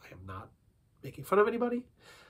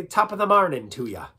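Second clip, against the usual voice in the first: loud, higher Irish lilt; Irish accent; 4 semitones higher, 5 dB louder than usual. Shown as a demonstration. Accent warm-up where we say, Irish accent. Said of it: "something in Irish".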